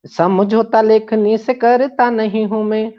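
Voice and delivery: declaimed verse with repeated lines; poem recitation. Acted. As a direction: recite it like a stage poet. Recites another stanza.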